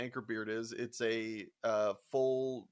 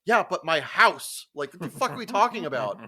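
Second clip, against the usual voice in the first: indignant retort; 5 semitones higher, 9 dB louder than usual. Retold or imitated. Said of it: "I was like".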